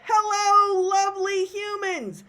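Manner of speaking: high, singing, drawn-out greeting; welcoming emcee. Shown as a demonstration. Emcee welcoming the audience, high and gracious. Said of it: "So you could be like".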